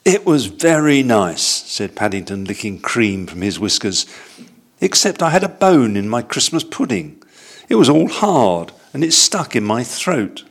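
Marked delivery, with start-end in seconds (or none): 0.0-1.6 slow, high little bear; a high, slow bear's voice; young bear voice. Acted. Paddington's voice in story. 4.8-10.4 high, earnest little bear; young bear voice. Acted. Paddington's voice in story.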